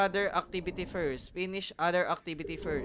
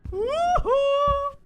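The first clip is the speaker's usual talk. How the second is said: high, loud triumphant whoop; excited cheer; 9 dB louder than usual. Real, not acted. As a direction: cheer like you just escaped. Escaping the monster.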